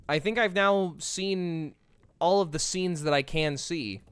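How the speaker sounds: ordinary delivery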